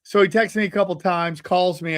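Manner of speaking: ordinary talk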